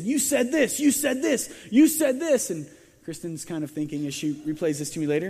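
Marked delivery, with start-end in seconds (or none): 0.0-2.5 higher and louder each time, building; accusing, escalating mock outrage; imitating an upset mother's accusations; rising anger. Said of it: "more and more upset".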